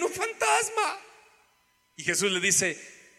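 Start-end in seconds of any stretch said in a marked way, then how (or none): 0.0-1.0 high-pitched acted yelp of fright; frightened cry. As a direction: cry out high in alarm, without extra volume. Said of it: "tienen miedo y gritan y dicen".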